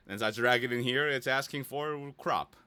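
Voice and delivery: ordinary talk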